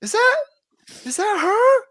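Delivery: very high, disbelieving squeal; surprised. Retold or imitated. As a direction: gasp it out in shocked disbelief. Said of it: "and I was like".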